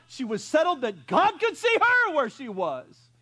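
high, loud preaching, slow on the short lines; a pastor preaching at full force. Acted. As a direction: drive the faith home to the congregation. Preaching on Flo's faith.

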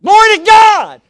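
sudden piercing high holler; excited yell. Retold or imitated. Dad beside himself as someone gets saved, ecstatic yell. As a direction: explode into a slow, drawn-out yell. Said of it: "you can't yell out like that".

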